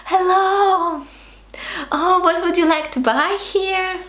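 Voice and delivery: high, bright and gushing, pitched well up; mock cheery welcome from a smiley shop assistant; overly cheerful shop greeting. Shown as a demonstration. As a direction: greet the customer like a beaming salesperson. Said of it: "That's not about, like".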